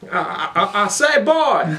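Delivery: high, loud, stammering Southern drawl; hammed-up Southern gentleman accent. Player missing the Northern accent, exaggerated Southern accent. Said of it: "That's Southern, not Northern".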